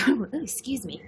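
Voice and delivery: ordinary delivery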